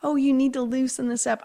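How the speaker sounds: lightly mock a worried, nagging mother; fretful mothering, played back as a retelling; fussing mother. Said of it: "I was being such a frickin' mother".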